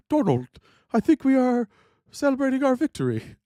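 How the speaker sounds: high-pitched, hammed-up French accent; mock a badly done French accent. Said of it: "it's a really good French accent".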